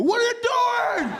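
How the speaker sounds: very high, loud mock shout; outraged yell. Stopped drivers yelling at a wrong-way driver, high outraged shout. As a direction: yell it like an appalled onlooker.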